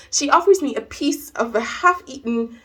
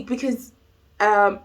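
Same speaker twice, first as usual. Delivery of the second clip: ordinary talk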